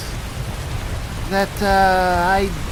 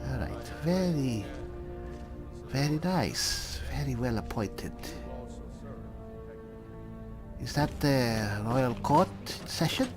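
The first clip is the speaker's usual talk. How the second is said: hushed admiration; 5 semitones lower, 11 dB quieter than usual. Pretend. Player admiring the well appointed royal court, hushed. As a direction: keep it quiet and measured, as if inside a royal court.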